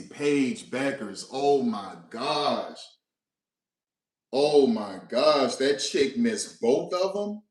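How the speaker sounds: slow, drawn-out, dazed; stunned disbelief. Real, not acted. Fan slowly repeating the news, stunned. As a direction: let each word hang in shock.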